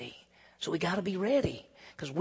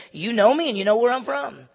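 sarcastic; 5 semitones higher, 7 dB louder than usual. Retold or imitated. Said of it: "he's really being a little bit, uh, sarcasm".

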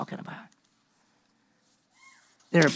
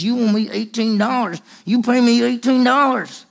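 rising, louder hammering demand; 4 semitones higher, 3 dB louder than usual. Acted. Slave throttling his debtor, building angry demand. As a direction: pound the amount home.

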